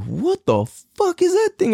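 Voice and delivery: high, incredulous yelp of surprise; a jump up in pitch, playing it up with mock shock; stunned disbelief. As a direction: act floored by the size of it. Stunned by the huge blunt.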